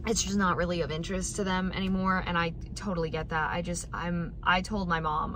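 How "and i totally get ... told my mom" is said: normal talking voice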